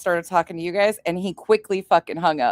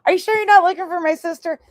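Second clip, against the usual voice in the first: higher, louder fake-sweet question; higher, louder mock-innocent take; 9 semitones higher, 5 dB louder, faster than usual. Shown as a demonstration. The line she almost said to the caller, higher and louder. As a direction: sweetly play dumb, up high and fast. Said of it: "That's what was gonna come next".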